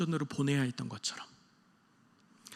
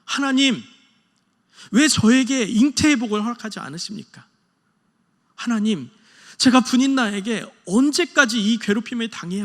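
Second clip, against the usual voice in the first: anguished crying out to God; 4 semitones higher, 6 dB louder than usual. Retold or imitated. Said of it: "괴로운 마음에 하나님께 울부짖습니다".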